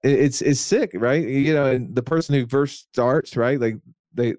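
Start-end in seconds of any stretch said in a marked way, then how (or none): none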